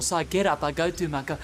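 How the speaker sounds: normal talking voice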